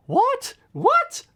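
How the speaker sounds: very high squeaked what; squeaky what. Retold or imitated. Reciting the old video's gag for viewers, high squeaky what. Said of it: "I put on a voice and I say".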